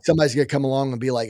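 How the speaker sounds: ordinary delivery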